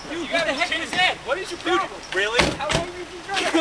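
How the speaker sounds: high fast exasperated yelling. Acted. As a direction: yell at a bad driver. Yelling at bad driver.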